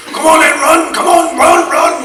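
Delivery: high, loud mock Welsh coach yell; Welsh PE master shouting. PE master yelling at slow runners, loud and high. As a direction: bark at the kids to keep running. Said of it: "Welsh P E master used to come up behind you".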